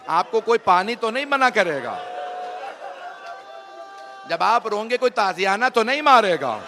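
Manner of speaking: building from strong to loud mournful cry; rising grief-stricken sermon cry; rising lament. Real, not acted. Majlis preacher rising lament.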